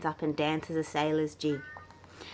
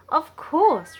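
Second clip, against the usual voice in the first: eager reply; 11 semitones higher, 8 dB louder than usual. Acted. Wiggles answer eagerly.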